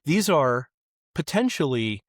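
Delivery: ordinary delivery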